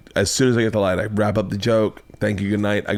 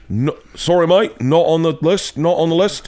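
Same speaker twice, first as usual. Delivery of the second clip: higher-pitched mock British brush-off; British dismissal; 7 semitones higher than usual. British doorman refusing a comic at the front door, mock British dismissal. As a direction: curtly turn them away in a put-on British accent. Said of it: "being dismissed by a British person".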